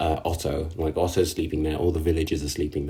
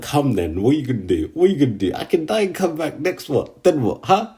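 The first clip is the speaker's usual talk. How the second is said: taunting Subaru; 7 semitones higher than usual. Retold or imitated. Subaru taunting Garfield who grabbed his collar, mock taunt. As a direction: goad him, come at me. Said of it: "he's like".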